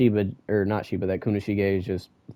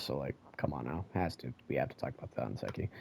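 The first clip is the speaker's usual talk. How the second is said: mumbled aside; 9 semitones lower, 17 dB quieter, faster than usual. Real, not acted. Trailing aside.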